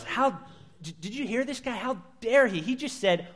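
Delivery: high, indignant outrage; mock-scandalized scribe, voice jumping high; irate scribes. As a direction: sputter in disbelief at the blasphemer. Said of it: "They're irate, upset".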